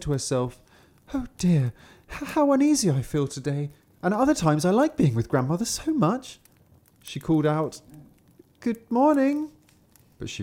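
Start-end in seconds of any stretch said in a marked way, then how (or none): 1.1-6.4 put-on high, worried child; uneasy little girl. Kapturek czuje niepokój. 8.6-9.5 high, sing-song greeting; little girl calling. Acted. Kapturek woła babcię.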